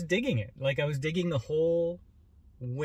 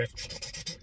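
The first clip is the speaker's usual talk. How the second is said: rapid voiceless chattering hiss; quick whispery sputter of fast-forwarded action; fast-forward noise; 9 dB quieter than usual. Retold or imitated. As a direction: imitate sped-up film with quick "ch-ch-ch". Imitating fast-forwarded fight footage.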